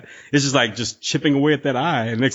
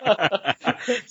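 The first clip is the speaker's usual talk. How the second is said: high laugh; 11 semitones higher, 4 dB quieter than usual. Real, not acted. Co-host laughs along.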